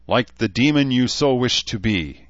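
plain reading voice

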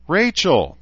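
high, loud squeal of a unicorn; excited high-pitched squeak; squeaky unicorn. A narrator's reading. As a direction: squeak the name out loudly. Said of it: "the unicorn squeaked".

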